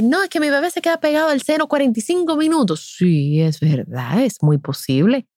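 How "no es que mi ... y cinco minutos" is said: higher, fast, a touch of a worried mother; quoting a mother. Retold or imitated. Nurse quoting a worried mother, lightly voiced and fast. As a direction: lightly play the anxious mother. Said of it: "cuando una madre me dice".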